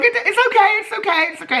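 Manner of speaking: imitated high, sweet consoling voice; sweet reassurance. Imitating a racer consoling her upset partner, high sweet voice. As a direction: comfort them in a very high little voice. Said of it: "she was so sweet. She's like".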